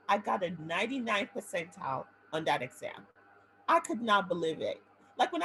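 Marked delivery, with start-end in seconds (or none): none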